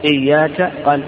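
ordinary talk, nothing marked

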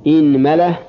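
إنملة as "inmala", i first, a after mim; kasra then fatha. Shown as a demonstration. Teacher starting the kasra set, إنملة as "inmala". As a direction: say إنملة with opening i and middle a. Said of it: "كسر الهمزة".

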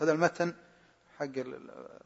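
ordinary talk

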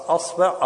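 "أصبع" as asbi', a then i; fatha on hamza. Shown as a demonstration. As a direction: say "أصبع" with a first and i after the b. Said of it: "افتح الهمزة". (نطق "أصبع" بفتح الهمزة وكسر الباء)

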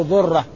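normal talking voice

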